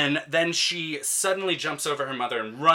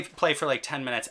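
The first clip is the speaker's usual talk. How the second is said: usual speaking voice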